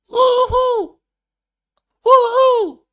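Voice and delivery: very high, loud squealed woohoo with a laugh; falsetto girly woohoo. Pretend. Streamer squealing woohoo in falsetto for chat. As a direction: squeal it way up like a girl. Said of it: "don't expect me to have a girl's voice".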